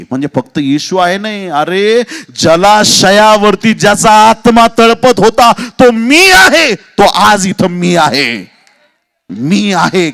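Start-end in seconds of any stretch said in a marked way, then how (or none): 1.5-10.1 very loud, high, thundering proclamation; full-force preaching. Real, not acted. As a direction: proclaim it at the top of your voice. Preaching climax on I am.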